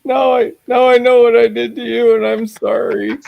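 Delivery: high, tearful mock apology; mock bawling. Daughter admitting what they did to a parent, mock bawling. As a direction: put on a wailing daughter's voice. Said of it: "bawling her eyes out, totally losing it".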